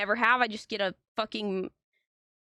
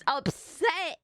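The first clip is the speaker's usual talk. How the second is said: ordinary delivery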